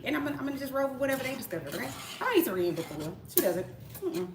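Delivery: ordinary delivery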